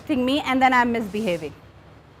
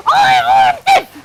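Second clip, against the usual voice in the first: much higher shouted whine; child's tantrum shout; 8 dB louder than usual. Acted. Counsellor as the child, yelling for chips in a tantrum, much higher and louder. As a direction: scream it like a child throwing a tantrum. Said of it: "I'm your child".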